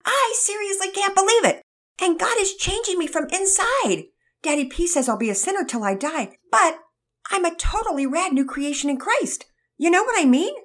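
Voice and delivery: put-on high, eager boy voice; a gushing young believer; excited teen boy. Todd voiced in a read story.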